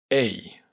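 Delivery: "ei" pronunciado tal como se escribe; diptongo ei. Shown as a demonstration. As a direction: di "ei" como en español. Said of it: "se pronuncia exactamente como se escribe". (diptongo "ei" claro)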